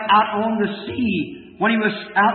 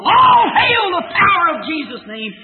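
hymn words cried out much higher and louder; higher, louder fervent hymn quotation; shouted hymn lines; 8 semitones higher, 5 dB louder than usual. Acted. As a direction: proclaim the hymn like a rallying cry.

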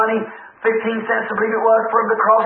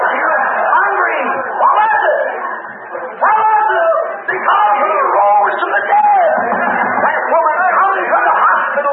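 high, fervent preacher's shout; exultant, drawn-out pulpit cry; full-force preaching; 8 semitones higher, slower than usual. Real, not acted. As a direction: proclaim it to the whole congregation.

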